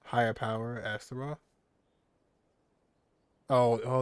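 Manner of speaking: normal talking voice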